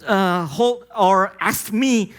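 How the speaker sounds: slow, high, pleading; a mild hint of his despair; slow plea. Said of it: "dia katakan".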